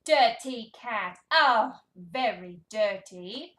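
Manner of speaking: loud, drawn-out storybook dismay; mock disgust. Reader showing toddler dirty cat, slow exaggerated yuck. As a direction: react big to a mucky cat.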